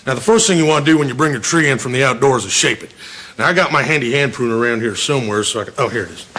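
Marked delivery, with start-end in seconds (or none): none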